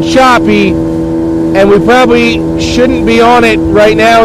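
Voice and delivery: high, loud yelling over a boat motor; shouting over engine. Real, not acted. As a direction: belt it out over roaring engine noise. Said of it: "Now, I'm gonna scream here".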